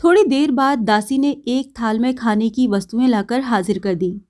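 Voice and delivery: plain reading voice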